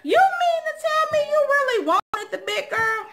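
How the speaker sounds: high, mocking laughing voice; mocking disbelief. Mocking the guy who tried again, amused disbelief. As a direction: rub it in with a laugh. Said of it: "I was like".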